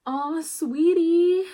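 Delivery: mock-tender nurse voice; cooing doctor. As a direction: draw out a pitying "aw" like a sweet doctor.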